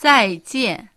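teacher modelling 再见; model pronunciation. Shown as a demonstration. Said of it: "では、発音してみましょう。".

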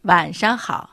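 晚上好 said carefully, tones clear; teacher demonstrating 晚上好 for the class; model pronunciation. Shown as a demonstration. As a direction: model 晚上好 for learners to repeat. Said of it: "では言ってみますよ".

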